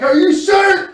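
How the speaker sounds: high, loud disbelieving shout; indignant shout. Retold or imitated. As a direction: yell it in outraged disbelief. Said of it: "I'm like".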